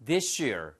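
"this year" said as "thi-shear"; native-style linked "this year"; blended sh sound. Shown as a demonstration. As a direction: slur the s of "this" into "year" as sh. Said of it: "이렇게 쉬 발음이 나요".

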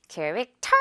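캐릭터 with a strong, aspirated 터 at the end; 캐릭터 with the weight thrown onto 터; wrongly stressed last syllable. Shown as a demonstration. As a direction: punch the 터 of 캐릭터 with a puff of air. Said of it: "이 부분을 강세를 주는 게 아니잖아요".

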